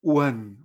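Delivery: "wen" with an e between u and n; Chinese un sound. Shown as a demonstration. Teacher summing up for learners, clear "wen" with e. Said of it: "Un no es chino".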